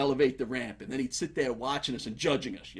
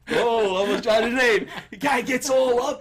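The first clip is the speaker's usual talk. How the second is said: normal talking voice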